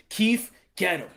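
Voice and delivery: low, very soft order; Al Heyman. Acted. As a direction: call an underling under the voice. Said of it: "Al Heyman's like".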